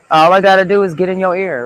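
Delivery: loud and fast. Real, not acted. Sudden loud fast burst.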